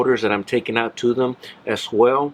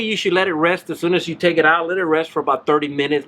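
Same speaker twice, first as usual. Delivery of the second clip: usual speaking voice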